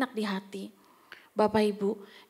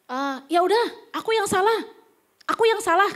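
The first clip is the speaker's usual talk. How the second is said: exasperated, louder mock concession; insincere apology; 6 semitones higher, 5 dB louder than usual. Husband cutting an argument short, insincere apology. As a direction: imitate giving in just to end it. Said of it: "minta maaf dengan tulus? Enggak".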